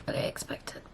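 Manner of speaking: a hushed, disbelieving whisper; stunned whisper. Real, not acted. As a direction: breathe it out, still in shock.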